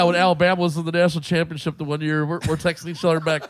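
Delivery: ordinary talk, nothing marked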